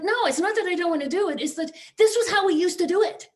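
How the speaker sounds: high, frustrated outburst; frustrated colleague. Retold or imitated. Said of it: "she's like".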